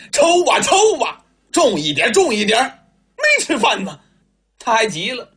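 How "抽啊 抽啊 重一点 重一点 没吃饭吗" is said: high, agitated demand to hit harder; impatient urging. Acted. Husband urges his wife to lash harder, impatient and high. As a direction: snap at her to put her back into it. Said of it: "陈独秀急了".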